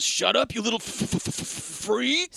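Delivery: high-pitched acted outburst breaking into a stutter; mock stuttering yell. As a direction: imitate an angry teacher, stuck on the F. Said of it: "And then he starts stuttering".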